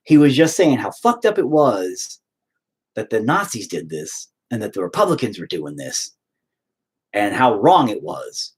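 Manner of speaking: usual speaking voice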